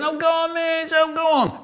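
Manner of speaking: high whiny begging; child pleading with the teacher. Retold or imitated. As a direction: wheedle the teacher.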